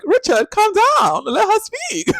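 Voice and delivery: very high, slow, loud hammed-up posh scolding; Hannah Waddingham's character. Party guest scolding Richard, very high slow hammed-up drawl. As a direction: drawl it out like a grand lady. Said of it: "the way she speaks".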